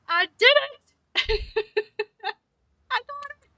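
high, giddy squeal with laughter; so happy. Real, not acted. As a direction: squeal it in triumph, breaking into laughs. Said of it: "I'm so happy".

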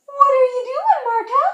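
high, loud cooing pet voice; squealing baby talk to her cat. Acted. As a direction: coo at the kitten like a baby.

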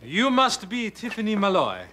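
lightly put-on fake French accent; a critic hamming up a French accent; phony French accent. Critic introduces himself to the young painter in a phony French accent. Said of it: "a phony accent like this".

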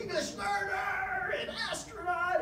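high, slow, shouted declaration, half mock outrage; indignant shout. Telling STEM meeting staff who he is, an indignant slow shout. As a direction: belt out your credentials like a rallying cry. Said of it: "I was so angry".

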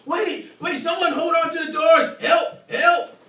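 high, loud frantic calling for help; panicked shout. Retold or imitated. Late commuter shouting frantically down the train steps. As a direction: yell desperately for someone to hold the doors.

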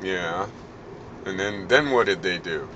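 usual speaking voice

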